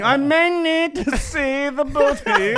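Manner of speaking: high, slow royal mock voice.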